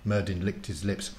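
plain reading voice

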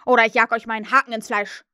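loud, fast threatening bark; angry threat. Acted. Peter as Hook threatens the crew, loud fast threat. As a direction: threaten them hard and fast. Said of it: "rief Peter".

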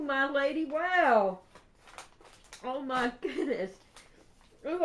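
ordinary talk, nothing marked

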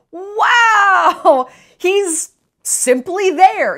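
hoog, traag gespeelde verbazing; sarcastic awe. Real, not acted. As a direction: overdrijf de bewondering sarcastisch. Sarcastic mock praise.

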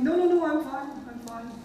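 high quick brush-off, dropping away at the end; insistent denial. Acted. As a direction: brush off the concern, insisting all is fine. Acts out denying being upset.

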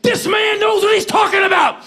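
high, loud shout of approval. Retold or imitated. Singer endorsing his fan at press conference, high hollered praise. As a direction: holler it high to the whole room. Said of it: "[name] goes like".

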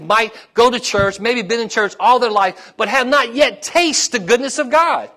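high-pitched, urgent sermon delivery; impassioned preaching. Real, not acted. Preacher urges congregation to taste God's goodness, impassioned.

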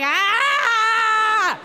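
high loud scream of pain; labor scream. Retold or imitated. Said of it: "she's like".

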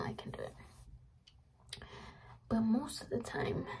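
soft whisper. Real, not acted. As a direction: keep it a whisper. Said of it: "I'm talking like this".

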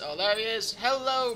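ordinary delivery

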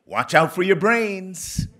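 high, loud, slow elder's warning; Ruby Dee. Retold or imitated. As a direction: sound like a grand old actress. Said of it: "walked up to me and said".

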